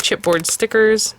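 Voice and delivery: normal talking voice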